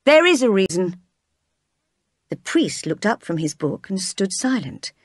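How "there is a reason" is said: ringing out from the back of the church; loud and clear, cutting in; a firm voice breaking the silence; clear call. A narrator's reading. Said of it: "said clearly".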